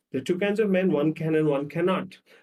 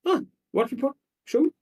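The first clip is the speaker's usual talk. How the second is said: high, abrupt questioning; short, businesslike questions pitched up; brusque problem-solver; 12 semitones higher, 4 dB louder than usual. Acted. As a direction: demand the facts like a logical fixer. Acted logical man reacting to complaint.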